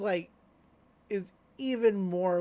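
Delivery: ordinary talk, nothing marked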